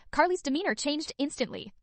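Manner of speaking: ordinary delivery, nothing marked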